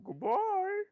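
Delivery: very high squeaky cartoon donkey voice, the staff talking; Video Game Donkey. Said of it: "voiced by Video Game Donkey".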